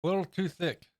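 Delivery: talking around a paintbrush in the mouth. Real, not acted. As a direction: mouth full, paintbrush between the lips. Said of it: "I got a paintbrush in my mouth".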